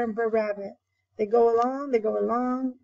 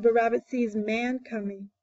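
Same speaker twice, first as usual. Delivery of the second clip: ordinary delivery, nothing marked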